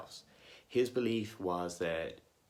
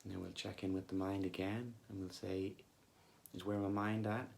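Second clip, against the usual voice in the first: soft, unhurried meditation voice with long pauses; guided meditation; 7 dB quieter than usual. Acted. Coach leading listeners back to body and breath, calm guided meditation. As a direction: gently guide them back to the breath, leaving long silences.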